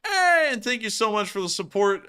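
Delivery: bright greeting. Real, not acted. Greeting donor warmly.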